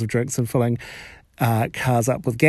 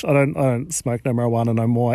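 ordinary talk, nothing marked

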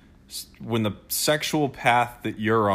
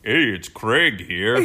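slow, laughing dopey guy voice; impression of Craig; 3 dB louder, slower than usual. Teasing her partner, a slow dopey Craig impression. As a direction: drag it out like a goofy dude. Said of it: "doing an impression of a guy's voice".

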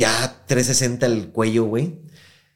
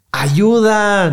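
higher, loud cry of "¡Ayuda!"; shout for help; 11 semitones higher, 9 dB louder than usual. Retold or imitated. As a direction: yell "¡Ayuda!" through the broken glass. (grito fuerte y agudo de "¡Ayuda!")

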